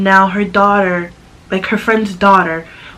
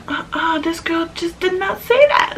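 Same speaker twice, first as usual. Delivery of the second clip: inner disbelief; 8 semitones higher, 4 dB quieter than usual. Retold or imitated. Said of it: "But deep down, I'm like".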